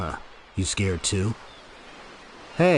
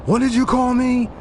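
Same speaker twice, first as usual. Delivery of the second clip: high, loud offended snap; indignant; 12 semitones higher, 4 dB louder than usual. Acted. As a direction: bristle at the insult, louder. Character reacts to insult.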